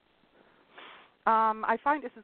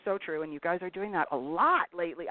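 ordinary talk

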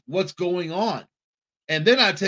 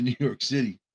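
ordinary talk

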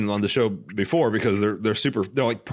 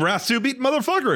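mock insult, pitched much higher; a hammed-up quote of the movie character's jab; quoting Dolomite's insult. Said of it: "He said".

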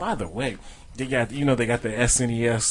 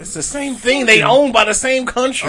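high-pitched, rising-volume insistence; insistent arguing; 11 semitones higher, 9 dB louder than usual. Real, not acted. Host insisting to friends on Thor's nationality, insistent. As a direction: argue it louder, refusing to back down.

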